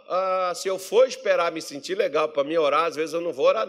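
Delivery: ordinary delivery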